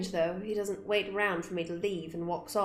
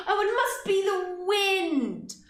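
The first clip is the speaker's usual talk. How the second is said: much higher, slow and loud; mock innocence; 12 semitones higher, 4 dB louder, much slower than usual. Player mimicking the excuse, high slow mock innocence. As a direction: say it with exaggerated fake innocence.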